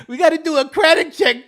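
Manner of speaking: words squeezed out through loud laughter, pitch jumping high; laughing. Real, not acted. Cracking up while mocking a scam job offer, laughing loud. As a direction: laugh so hard the words barely hold.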